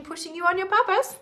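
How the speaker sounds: high squealing burst of excitement; excited. Real, not acted. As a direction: squeal with delight. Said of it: "I got really excited".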